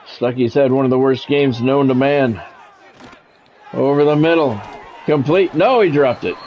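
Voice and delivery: ordinary talk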